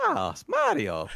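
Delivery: low, slow cartoon Italian voice; Mario. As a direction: put on a drawn-out Italian plumber voice. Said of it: "Where's the voice?".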